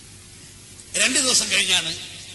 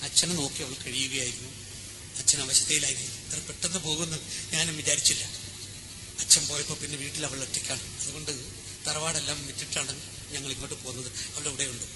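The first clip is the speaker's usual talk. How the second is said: much softer, lower explanation; quiet grieving; 6 semitones lower, 9 dB quieter than usual. Acted. Parvathi quietly tells Devayyan about her sister. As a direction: speak low and hushed, still in grief.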